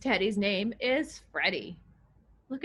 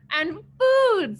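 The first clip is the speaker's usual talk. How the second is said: eager; 8 semitones higher, 8 dB louder than usual. Acted. Monster repeats, eager.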